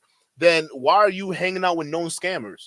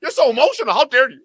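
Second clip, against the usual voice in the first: mocking outrage; 7 semitones higher, 7 dB louder, faster than usual. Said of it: "when they can't answer the question".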